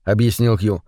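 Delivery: ordinary delivery, nothing marked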